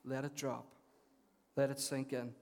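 quiet, letting it sink in; soft and reflective. Shown as a demonstration. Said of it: "pausing for reflection".

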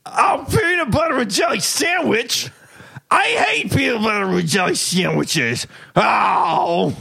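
high, slow, crying complaint; hammed-up sob over lunch; wailing grumpy old man. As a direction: wail it like the sandwich ruins his day. Worker's repeated complaint, louder.

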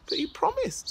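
high pleading little voice; hurt plea. Acted. Little Rock reminding Big Rock of his promise, high hurt plea. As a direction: plead like a betrayed friend.

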